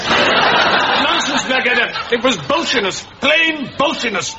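high-pitched blustering officer; indignant dismissal. Acted. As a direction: brush the excuse aside with bluster. General dismissing the excuse indignantly.

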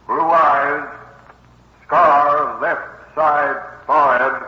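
staccato radio dispatch, item by item; police bulletin read. Acted. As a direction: read it like a wanted bulletin, pausing between details. Reads a wanted bulletin.

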